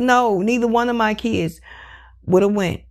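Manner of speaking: normal talking voice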